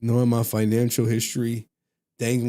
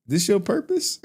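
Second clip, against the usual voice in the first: incredulous high question; 10 semitones higher, 3 dB louder than usual. Retold or imitated. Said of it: "I'll be like".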